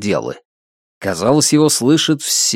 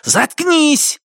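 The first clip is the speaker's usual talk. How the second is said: yelling. Acted. Repeated yell at dog.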